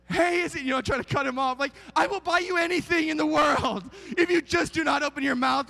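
high, loud desperate bargaining; frantic pleading. Retold or imitated. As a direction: shout the bribe to shut the kid up. Parent bribing child mid-story.

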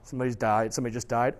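ordinary talk

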